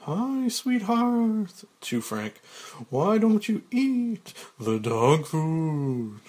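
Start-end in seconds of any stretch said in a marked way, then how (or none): 0.0-1.6 slow, high, flirty drawl; Lou Wexler. Acted. Lou winking at Claire, slow high flirty drawl. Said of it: "Winking at Claire". 2.9-6.2 slow, loud, drawn-out character voice; Lou Wexler. Acted. Lou to Frank, slow loud drawling suggestion.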